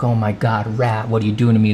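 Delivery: usual speaking voice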